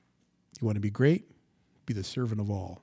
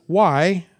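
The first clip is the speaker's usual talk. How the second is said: loud, higher, demanding "why"; Gideon's protest; 4 semitones higher, 6 dB louder than usual. Acted. Gideon questioning the Lord, loud raised demand. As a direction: throw it up at God as a challenge. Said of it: "You ever talk like that?".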